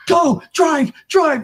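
retold wife yelling at him to drive away; panicked yell. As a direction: scream at him to floor it.

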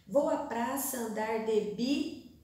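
"bi-" held with rising tune, word left unfinished; broken off mid-word. Shown as a demonstration. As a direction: stop short on a lifted "bi-", as if out of line. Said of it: "não deu mais espaço para ela escrever". ("bi-" suspenso, palavra cortada no meio)

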